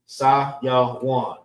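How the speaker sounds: Sayawan spoken in three clear syllables, sa-ya-wan. Shown as a demonstration. Teacher stressing to the class that Sayawan has three syllables. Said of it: "Three syllables".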